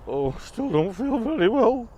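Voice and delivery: high, slow, sorry for himself; queasy mouse. Acted. Mouse still feeling sick, slow and high. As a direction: moan it slowly.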